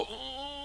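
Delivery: soft, high-pitched drawn-out ooh; mock swooning squeal, kept quiet; long high coo of delight; lovey-dovey ooh. Both go "ooh" after hand squeezes.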